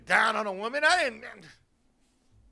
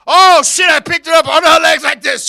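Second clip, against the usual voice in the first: loud, excited build; hammed-up loud excitement; loud climax; 5 semitones higher, 15 dB louder than usual. Real, not acted. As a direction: belt the big moment.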